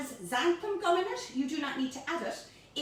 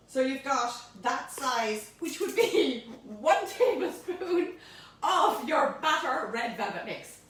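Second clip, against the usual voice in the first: usual speaking voice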